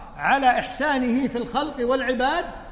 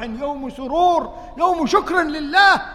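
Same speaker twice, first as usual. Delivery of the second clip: slow, then louder and higher words; emphatic rebuke; 6 semitones higher, 6 dB louder, slower than usual. Acted. Abdulrahman rebuking Umar for weeping on a day of joy, slow emphatic cry. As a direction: stretch each phrase, then cry out.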